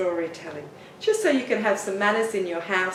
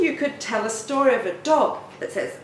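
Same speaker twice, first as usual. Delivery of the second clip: ordinary talk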